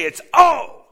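high loud cry of pain. Shown as a demonstration. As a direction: let the oh burst out. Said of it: "In English today".

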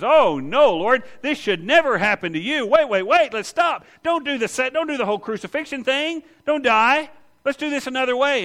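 high, loud, panicked pleading; frantic Peter. Retold or imitated. Peter begging Jesus not to die, loud frantic plea.

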